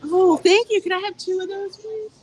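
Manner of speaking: bright, high, thrilled thank-you; excited thanks to the shop clerk who found it; delighted thanks. Real, not acted. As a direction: gush your thanks to the clerk.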